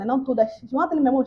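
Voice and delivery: ordinary delivery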